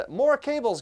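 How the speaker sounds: amused, high and loud exclamation; laughing. Real, not acted. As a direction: laugh it out in disbelief. Laughing at the cable pile.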